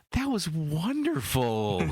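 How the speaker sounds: slow, high-pitched gushing delight; mock rapture. Interviewer acting out an author thrilled by a death scene, mock rapture. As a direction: draw it out in exaggerated admiration.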